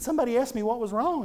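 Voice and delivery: high-pitched imitation of a young son; six-year-old boy. Son telling his daddy what he did, high child impersonation. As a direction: pitch it up like a little boy explaining. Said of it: "Here's a six-year-old boy saying".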